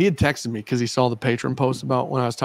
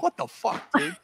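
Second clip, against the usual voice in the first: high-pitched, softer mock outrage; mock disbelief; 7 semitones higher, 6 dB quieter than usual. Host retells hearing Matt's already done, mock disbelief. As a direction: squeal it as an incredulous reaction.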